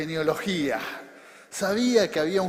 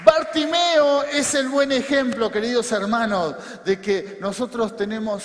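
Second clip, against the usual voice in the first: loud, raised pulpit voice; full-force preaching; 3 semitones higher, 6 dB louder than usual. Real, not acted. Preacher telling the brothers that God wants to rescue them, full-force preaching. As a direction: build to loud, high exhortation.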